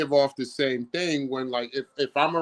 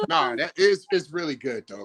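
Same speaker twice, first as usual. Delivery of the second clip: ordinary talk, nothing marked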